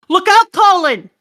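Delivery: high, loud cry; urgent warning. Acted. Father warning Colin, urgent cry. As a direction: shout a warning to a child.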